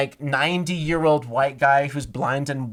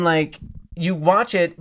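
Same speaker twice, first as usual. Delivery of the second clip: usual speaking voice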